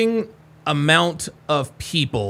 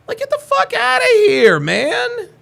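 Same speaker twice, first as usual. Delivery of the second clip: much higher, louder scoff; incredulous outburst; 5 dB louder, slower than usual. Real, not acted. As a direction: laugh it off in disbelief. Scoffing at suspect's paranoia.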